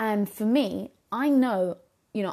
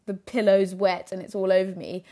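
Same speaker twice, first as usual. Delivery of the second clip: ordinary talk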